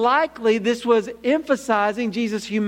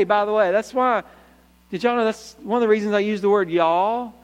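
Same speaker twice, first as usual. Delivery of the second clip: usual speaking voice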